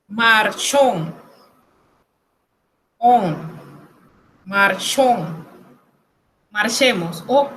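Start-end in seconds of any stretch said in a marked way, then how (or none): 0.1-5.4 "Marchón" in French, ending in nasal "on"; nasal sound demo. Shown as a demonstration. Teacher showing O N, nasal sound demo. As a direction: split "Marchón" and ring "On" through the nose. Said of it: "Sonido nasal".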